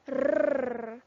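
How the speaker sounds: "R" as a long loud rolled "rrr"; letter sound. Shown as a demonstration. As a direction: hold a trilled "R" loudly. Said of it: "can you say the sound of each letter".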